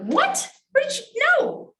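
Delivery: high, loud incredulous "What?"; shocked disbelief. Retold or imitated. Answering his wife's narrative, a loud high shocked "what". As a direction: jolt up in pitch in stunned disbelief.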